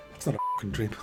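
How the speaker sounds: normal talking voice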